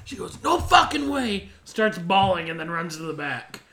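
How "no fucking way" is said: high, loud, drawn-out protest; imitating an upset yell; retold outburst. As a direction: cry out in slow disbelief. Retold upset outburst after being hit.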